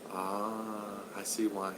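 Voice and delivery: very soft, low retold remark; imitated quiet realisation. Mother finally understanding after seeing the wife, imitated very quietly. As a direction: drop right down, hushed and low. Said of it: "like that".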